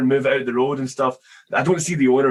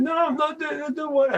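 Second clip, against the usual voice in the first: put-on high whiny child voice refusing; crying kids. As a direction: imitate a sulky little kid saying no. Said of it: "the other two kids started crying".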